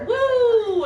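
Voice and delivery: high, loud drawn-out "woo"; imitated shirt whoop. Friend quoting a favorite shirt, high loud imitated whoop. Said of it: "it's like he is".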